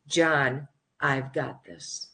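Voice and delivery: calm, hushed divine reassurance; quiet voice of God. Retold or imitated. God's voice retold to John.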